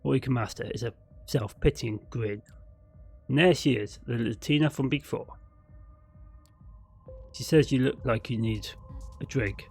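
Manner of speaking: usual speaking voice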